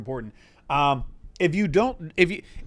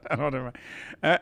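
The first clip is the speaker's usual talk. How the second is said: ordinary delivery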